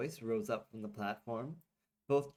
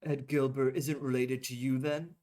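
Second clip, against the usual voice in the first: louder call across the pad; John yelling after the departing chair, louder; yelling after them; 3 semitones higher, 6 dB louder than usual. Acted. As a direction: call out to someone walking away. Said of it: "could yell after them".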